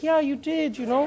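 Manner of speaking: high-pitched German friend. Acted. Friend confirming the spoiled day, frank. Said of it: "my friend was like".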